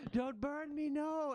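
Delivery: high, loud squealing rat in terror; panicked plea. Acted. Ratling begging the ghost after a firebolt, high panicked squeal. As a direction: beg in a high acted panic.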